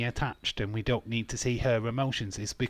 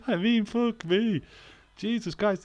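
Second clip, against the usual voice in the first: high, slow words through laughter; laughing at his own joke about Gable; laughing exclamation; 10 semitones higher than usual. Real, not acted. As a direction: crack up in disbelief. Laughing at Chad Gable bit.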